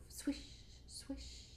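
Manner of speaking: whispered swishing leaves; soft swish. Acted. Leaves sound effect.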